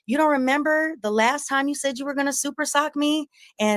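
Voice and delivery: high, mocking taunt. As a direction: tease him from way up high. Taunting Corey in the street.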